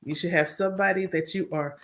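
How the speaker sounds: ordinary talk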